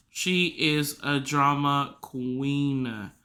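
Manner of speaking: drawn-out, deliberate verdict on each word; slow emphasis. Real, not acted. Podcaster repeating her verdict on the pet, slow and emphatic. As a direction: spell it out very slowly for effect.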